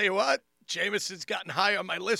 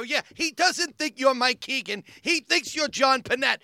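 raised, louder comic rebuttal; loud insistent correction; 5 semitones higher, 8 dB louder than usual. Retold or imitated. Comic correcting his friend Keegan before a fan, loud insistent correction. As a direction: drive the correction home, high and loud.

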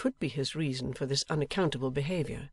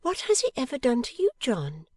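high-pitched wifely question; Bella, challenging wife; 10 semitones higher, 3 dB louder than usual. A narrator's reading. Wife demands what the man did to husband, challenging. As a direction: press him, pitched well up. Narrator voices the wife's challenge.

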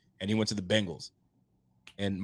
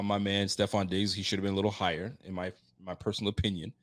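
ordinary delivery

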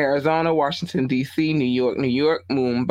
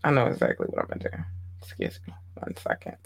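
muttered aside; 3 semitones lower, 8 dB quieter, faster than usual. Real, not acted. Mutters aside away from mic.

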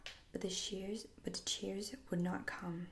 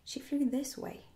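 higher, louder, a touch of worry; troubled; 7 semitones higher, 6 dB louder than usual. A narrator's reading. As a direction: insist with growing concern. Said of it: "becoming troubled".

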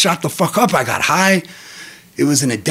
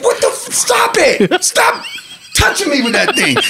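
mock yell; 11 semitones higher than usual. Telling son to stop.

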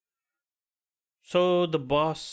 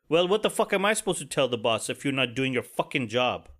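ordinary delivery

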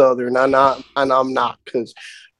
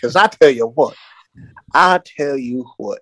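put-on Southern drawl; folksy Southern twang. Shown as a demonstration. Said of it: "my southern drawl, we can definitely do that".

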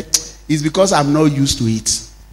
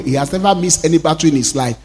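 ordinary talk, nothing marked

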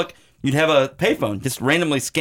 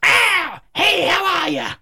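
put-on high-pitched baby monster greeting; comic hatchling voice; slower than usual. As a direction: squeal a silly hello, high and drawn out. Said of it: "it pops out and goes".

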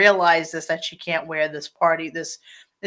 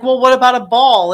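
eager Giselle; 5 semitones higher, 6 dB louder, faster than usual. Retold or imitated. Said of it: "And she's like".

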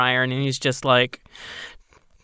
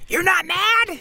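high disbelief; slower than usual. Acted. NPC skeptical reply.

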